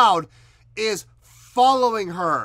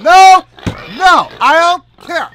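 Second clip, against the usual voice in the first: loud high shout of no; loud refusal; 8 semitones higher, 14 dB louder than usual. Real, not acted. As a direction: yell it, not stopping. Refusing to stop, excited.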